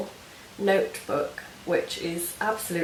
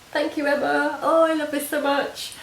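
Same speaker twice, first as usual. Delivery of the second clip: high, delighted gush; 9 semitones higher, 4 dB louder than usual. Real, not acted. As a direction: gush over the gift. Delighted thanks to swap partner.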